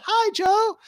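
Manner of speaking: high sing-out group hello; very high, drawn-out call; audience greeting. Retold or imitated. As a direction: imitate a crowd chorusing a greeting. Acting the whole audience greeting Joe.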